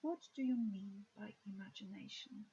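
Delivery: ordinary delivery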